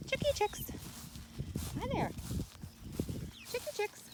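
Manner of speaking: high sing-song chick call; calling chickens. Real, not acted. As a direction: coax the chicks over in a high voice.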